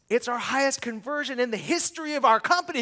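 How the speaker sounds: thrilled news over the phone; excited announcement. Retold or imitated. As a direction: share record news in triumph. Announcing record conversion, excited.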